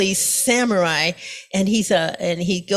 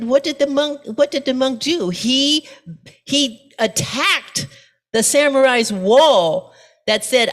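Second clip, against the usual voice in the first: usual speaking voice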